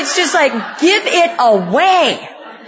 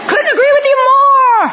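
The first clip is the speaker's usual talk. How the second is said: much higher, laughing through the words; 11 semitones higher, 4 dB louder than usual. Retold or imitated. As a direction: laugh it out in mock agreement. Laughing at her own agreement.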